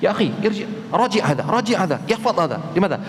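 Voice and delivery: Arabic-speaking student urging friend. Retold or imitated. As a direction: push the friend to review this. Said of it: "Ngomong ke temannya".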